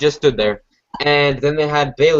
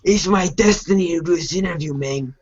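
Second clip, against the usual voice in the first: slightly raised, hammed-up Mexican accent; mock Mexican accent; 4 semitones higher than usual. Repeating the Del Rio impression, mock Mexican accent. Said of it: "Del Rio, like, uses an accent".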